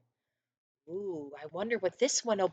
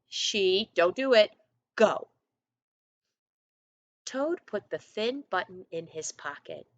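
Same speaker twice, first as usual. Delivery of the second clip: plain reading voice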